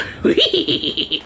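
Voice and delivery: high cartoon dog snicker; Scooby laugh. Shown as a demonstration. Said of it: "I can do the Scooby laugh".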